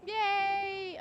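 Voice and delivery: high, loud drawn-out yay; whooping little celebration; excited cheer. Real, not acted. Cheering as riders finally arrive.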